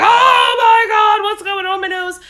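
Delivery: high, loud panicked squeal; inner panic. Retold or imitated. Mom panicking inside over her son's nosebleed, panicked high cry. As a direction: let the hidden panic burst out high.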